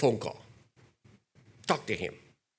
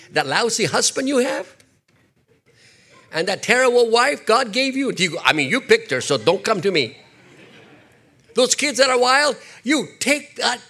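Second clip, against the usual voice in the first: preaching louder; 7 semitones higher, 5 dB louder than usual. Acted. Preacher teasing the congregation.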